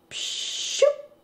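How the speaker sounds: high breathy pshoop; airy swoosh sound; magic whoosh. Acted. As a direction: whoosh of a machine finishing. Machine makes the goldfish.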